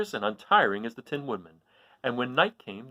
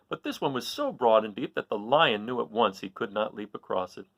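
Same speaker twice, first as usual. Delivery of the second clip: plain reading voice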